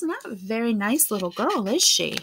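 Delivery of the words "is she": plain reading voice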